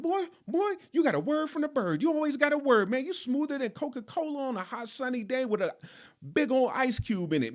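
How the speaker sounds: high-pitched, quick-talking friend impression; Terrence. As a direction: rattle it off high and smooth, like a hyped-up buddy. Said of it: "he just had this pitch in his voice. He'd be high".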